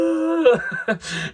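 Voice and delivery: high, loud crying wail; a high sobbing cry; tearful wail. Retold or imitated. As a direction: wail through tears, half laughing at yourself. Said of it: "floods of tears".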